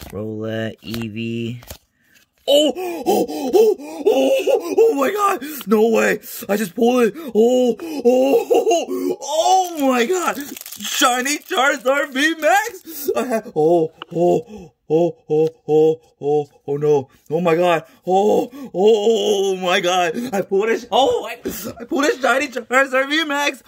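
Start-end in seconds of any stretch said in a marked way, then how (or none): 2.5-13.1 shrieking high with joy, crying out; screaming over a jackpot pull; shrieking jackpot winner. Real, not acted. Pulls rare card, screams. 13.2-20.1 loud stunned oh-my-gods; can't believe the pull, loud; shrieking jackpot winner. Real, not acted. Keeps reacting loudly.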